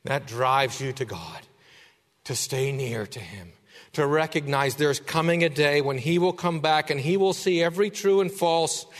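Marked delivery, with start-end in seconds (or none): none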